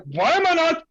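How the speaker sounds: high, hammed-up exasperated yell; mock frustration. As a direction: act losing it over failing to calm down. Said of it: "if you find yourself trying".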